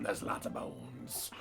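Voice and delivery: slow, soft whisper; drawn-out whisper. Acted. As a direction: stretch it out in a hushed whisper. Joking aside, trails into whisper.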